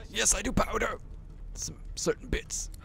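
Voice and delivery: high, bright-eyed hero voice, cheerfully indignant; player character voice. Acted. Player voicing her hero back at the dwarf, high and indignant. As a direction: play the character defending her vanity.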